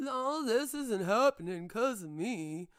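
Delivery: loud, slow, mocking whine of CJ; mocking impression of a streamer dodging blame. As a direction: sneer out his excuses slowly. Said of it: "he sits back and he goes".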